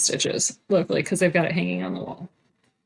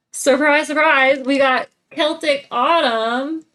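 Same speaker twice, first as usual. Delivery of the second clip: excited; 5 semitones higher, 4 dB louder than usual. Real, not acted. Teasing reveal of a pattern.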